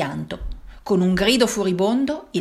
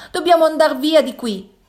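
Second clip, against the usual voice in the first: urgent plea; 8 semitones higher, 4 dB louder than usual. A narrator's reading. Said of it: "ansimò Sara".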